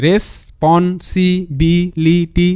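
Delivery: very slow, every syllable set apart. Shown as a demonstration. Teacher shows students how to practise, syllable by syllable. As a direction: sound out responsibility in separate beats. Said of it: "এরকম করলে কিন্তু ধীরে ধীরে clear".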